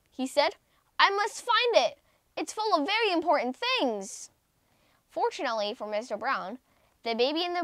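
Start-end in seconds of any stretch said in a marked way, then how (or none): 1.0-4.3 higher, urgent and anxious; frantic worry. Acted. Businessman vows to find his briefcase, frantic. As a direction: push the words out in a fluster.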